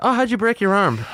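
high-pitched mock questioner; mock concerned question. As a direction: ask it high, like a concerned onlooker. Imagined questioner reacting to injury.

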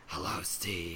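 deep, slow, soft demon growl; low creepy demon greeting Steve. Retold or imitated. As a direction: drop way down into a sinister demon voice. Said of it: "when I say demon voice".

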